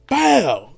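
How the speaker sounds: high punchy imitated yelp; imitated pop yelp; mock pop shout. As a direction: bark out the song's hit. Imitating song hit.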